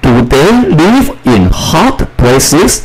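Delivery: high, slow questioning read; slow model question. Shown as a demonstration. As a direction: ask it slowly for the class. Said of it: "第四个". (高音慢速示范问句)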